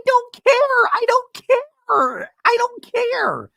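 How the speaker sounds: very high, slow crying wail; hammed-up high sobbing; mock sobbing. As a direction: wail it through fake tears. Imitating Shannon's shrill breakdown.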